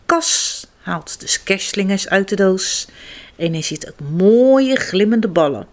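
usual speaking voice

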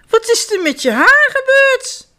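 high, loud alarmed question; a mother's alarmed outcry. A narrator's reading. Mother sees the bald children on the step, alarmed and high. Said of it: "riep ze".

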